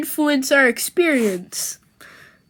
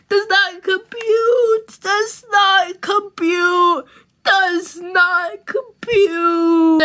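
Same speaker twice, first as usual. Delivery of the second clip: robot meltdown; 5 semitones higher, slower than usual. Acted. Robot overloads from being hugged.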